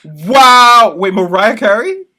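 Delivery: loud, high disbelief; shocked. Real, not acted. Shocked at [name] ranking.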